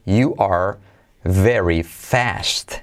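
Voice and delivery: "very fast" drawn out, very slow; slow demo. Shown as a demonstration. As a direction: stretch out "very fast" to show each sound. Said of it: "qué tenemos aquí a nivel de pronunciación".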